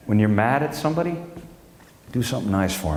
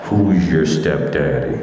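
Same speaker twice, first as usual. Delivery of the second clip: low, slow come-on voice, laughing; mock sexy stepdaddy; 7 semitones lower, 4 dB louder, slower than usual. Comedian voicing his sleep machine as a low seducer. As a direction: growl it like a creepy seducer.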